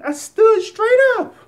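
sudden high, loud burst of joy; excited. Real, not acted. Man with MS standing up on camera, shouting excitedly. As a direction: shout it out, thrilled you just stood up. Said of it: "I'm a little excited".

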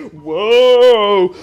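very slow, loud stretched whoa; drawn-out whoa. Acted. Marvelling at the bent dick, a slow loud whoa. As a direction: let the whoa roll out in awe.

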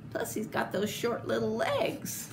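soft unvoiced aside to the kids; whispered aside. Shown as a demonstration. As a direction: whisper it like a secret. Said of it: "aside whisper".

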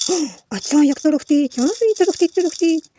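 high, loud sing-song marvelling; drawn-out playful cooing; excited baby talk. Pretend. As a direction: wow the baby with a rattle. Shaking rattle for baby.

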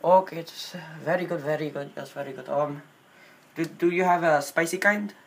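put-on halting Indian-accented English. Prank caller asking a wings place for llama, in Indian accent.

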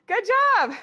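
high loud cheerful praise; excited praise. Real, not acted. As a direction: cheer them on brightly. Coach praise after workout.

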